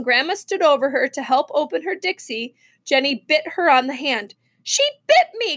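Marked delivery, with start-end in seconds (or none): 4.6-5.6 high-pitched outcry of indignation; startled, high grandmotherly protest; shocked cry. A narrator's reading. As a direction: cry out as if just bitten. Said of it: "Grandma cried".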